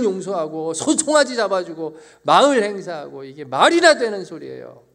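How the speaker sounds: loud, high, heated complaint; indignant protest. Retold or imitated. Congregation member siding with the elder son, loud and indignant. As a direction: protest hotly on the son's side. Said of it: "라고 하셨습니까?".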